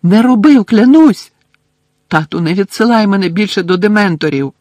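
high, loud frantic begging; terrified pleading. A narrator's reading. Son begging father not to send him to dementors. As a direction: beg not to be sent back.

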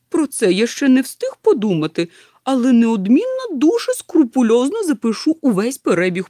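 loud, high, emphatic professor; eager professor. Acted. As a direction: promise it with scholarly zeal. (захоплено, гучно, по-професорськи)

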